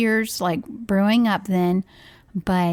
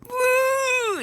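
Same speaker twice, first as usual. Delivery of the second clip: high, held mock squeal; imitated pent-up squeal. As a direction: squeeze out a long high "woo" like an upset child. Imitating son's pent-up noise.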